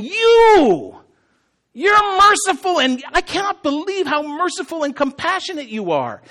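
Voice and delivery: high, loud, indignant outburst; ticked off. Acted. Jonah angry at God for being merciful, ticked off. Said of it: "He is ticked off".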